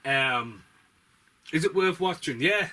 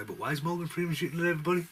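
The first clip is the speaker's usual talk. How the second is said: ordinary delivery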